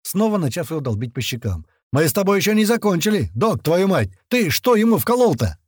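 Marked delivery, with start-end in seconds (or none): 1.9-5.6 high, loud, agitated demand; alarmed anger. A narrator's reading. Fighter demands what the medic injected, alarmed anger. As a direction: round on the doctor in alarm. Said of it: "встревожился Грач".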